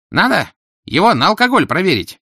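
loud, high bystander voice; cracked businesslike tenor. A narrator's reading. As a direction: pitch it up, officious cracked tenor. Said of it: "деловито предложил надтреснутый тенор".

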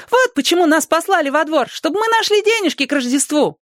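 put-on very high child's call; excited little boy. A narrator's reading. Small boy showing everyone money, excited high voice. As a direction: squeal the news like a small boy. Мальчик объявляет детям находку.

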